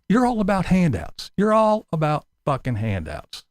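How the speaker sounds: ordinary talk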